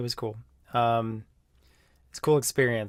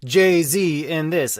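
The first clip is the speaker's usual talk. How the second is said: hyped announcer voice; 4 semitones higher, 6 dB louder, much slower than usual. Retold or imitated. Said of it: "And he's like".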